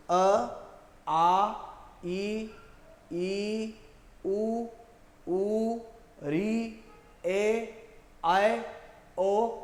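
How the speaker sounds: each vowel from अ to ओ sounded out one by one, आए and आओ as single vowels; vowels recited again. Shown as a demonstration. Teacher repeating all the vowels for students. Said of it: "तो फिर से बोल रहा हूँ".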